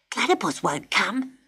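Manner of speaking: soft squeaky rat voice; quick rat protest. A narrator's reading. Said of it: "cried the rat".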